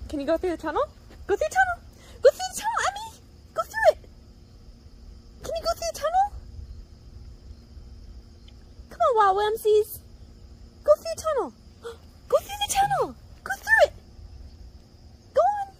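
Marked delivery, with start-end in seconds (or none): 1.3-1.8 much higher, bright sing-song urging; excited high pet talk. Real, not acted. 2.2-15.7 much higher, eager coaxing calls; high excited pet talk. Real, not acted. Urging dog through tunnel.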